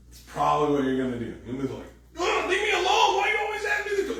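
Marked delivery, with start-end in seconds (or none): 2.2-4.2 much higher, louder, fast snapping; mock snap. Irritated spouse snapping at a prying question, mock high fast snap. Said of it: "You're gonna snap at them".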